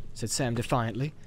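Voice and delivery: plain reading voice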